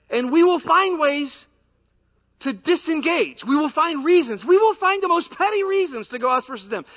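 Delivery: loud, high impassioned preaching; full-force sermon delivery. Acted. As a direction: preach it out with fire.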